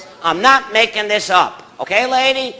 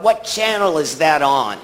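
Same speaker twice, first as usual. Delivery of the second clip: ordinary talk, nothing marked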